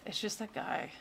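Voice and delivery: usual speaking voice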